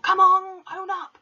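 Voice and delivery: hammed-up bully headmistress demanding a confession; Trunchbull roaring. A narrator's reading. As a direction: storm at the class as the angry headmistress. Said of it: "she roared".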